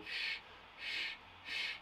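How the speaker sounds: sh hissed softly without voice; teacher showing the sh sound; hushing sh. Shown as a demonstration. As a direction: stretch the sh softly, unvoiced. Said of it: "that lovely sh sound".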